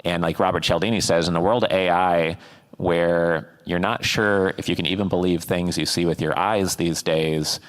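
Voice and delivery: ordinary talk, nothing marked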